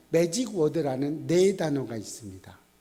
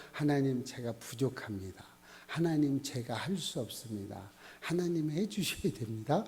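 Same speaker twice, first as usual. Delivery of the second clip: humble prayer; 2 semitones lower, 7 dB quieter than usual. Pretend. Voicing a humble repentant prayer.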